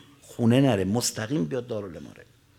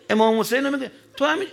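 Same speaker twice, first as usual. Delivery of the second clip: pleading reproach; 11 semitones higher, 5 dB louder, faster than usual. Acted. Zeynab repeats her reproach.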